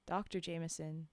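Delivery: plain reading voice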